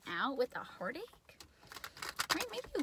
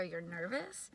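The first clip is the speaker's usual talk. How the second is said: normal talking voice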